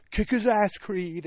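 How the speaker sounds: high, loud reenacted cheer; excited cheering. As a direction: egg the boxer on from the couch. Acting out cheering for Creed.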